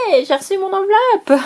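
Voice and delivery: excited. Retold or imitated. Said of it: "et lui dire".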